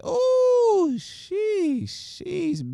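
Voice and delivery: very high, squealing ooh then sheesh; amazed reaction. Real, not acted. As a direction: let it fly up in sheer amazement. Reacting to a big matchup.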